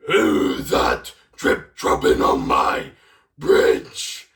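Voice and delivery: deep, slow, growling troll; slow booming storybook troll. Acted. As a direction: loom over the bridge like a hungry troll. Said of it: "the troll said".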